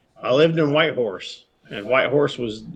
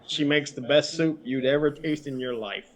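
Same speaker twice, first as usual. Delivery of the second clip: normal talking voice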